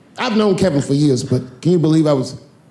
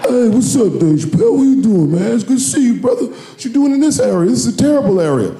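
bodyguard voice; 7 semitones higher than usual. Retold or imitated. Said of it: "one of Kevin's goons opened the door".

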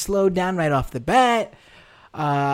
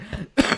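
sudden choking cough; choking on a drink; 6 dB quieter than usual. Real, not acted. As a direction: cough as if a drink went down wrong. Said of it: "Now I'm, like, choking".

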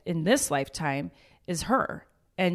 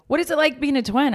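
mock curious question; 7 semitones higher, faster than usual. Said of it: "people are always like".

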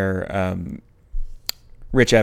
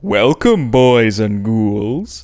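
jack o lantern voice; 4 dB louder, much slower than usual. Acted. Spooky Halloween show opener.

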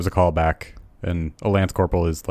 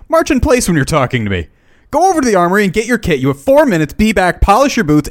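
higher, louder barked drill orders; drill sergeant yell; 10 semitones higher, 5 dB louder than usual. Acted. Lance corporal screams orders at fresh recruits, loud and higher. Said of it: "do you want me to read it?".

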